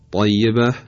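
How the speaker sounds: emphatic, dark T in «طیبه»; تلفظ درست ط. Shown as a demonstration. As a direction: correct «طیبه» with a heavy velarized ط. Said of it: "درستش هست". («طیبه» با طای پرحجم و مُطبَق)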